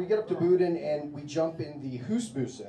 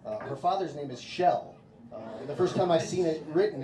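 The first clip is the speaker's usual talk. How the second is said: usual speaking voice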